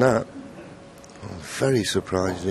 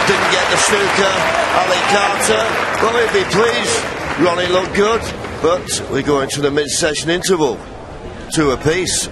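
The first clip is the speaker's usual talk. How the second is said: raised, higher commentator voice; excited commentary; 8 semitones higher, 4 dB louder than usual. Real, not acted. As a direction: lift the energy as the frame is won. Frame ends, going into the interval.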